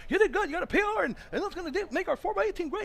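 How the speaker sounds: high, loud, laughing coach praise. Retold or imitated. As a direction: gush over the runner while hugging him. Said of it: "he gives these big hugs".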